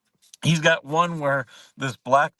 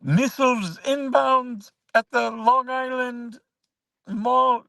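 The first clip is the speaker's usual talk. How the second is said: much higher, slow put-on voice of a prank caller; 13 semitones higher, slower than usual. A prankster on the phone, hamming up a missile alert in a high, slow put-on voice. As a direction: lay it on thick like a fake emergency caller. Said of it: "he was like".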